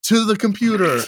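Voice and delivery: loud, mock-grand self-hype; hammed-up boast. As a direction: boast it loudly, tongue in cheek. Hams up boast about own voice.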